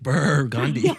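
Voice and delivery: "Burr" drawn out like brrr, then "Gandhi"; shivering cold. Pretend. Host joking about a cold guru, "Burr" as a shiver. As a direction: split the name into a chilly "Burr" and "Gandhi". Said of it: "like a cold Indian guru".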